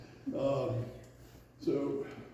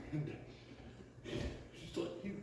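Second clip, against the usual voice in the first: ordinary delivery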